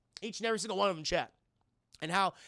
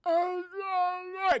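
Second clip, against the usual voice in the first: higher muffled moan; mock tongueless mumble; 10 semitones higher, 3 dB louder, much slower than usual. [name] without teeth, mock mumble. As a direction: talk like your tongue is gone.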